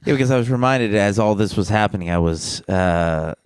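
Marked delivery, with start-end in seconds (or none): none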